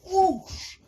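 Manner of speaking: high, forceful whoosh noise; sudden rushing mouth effect; imitated whoosh. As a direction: make the sound of someone swept off. Imitates man being swept away.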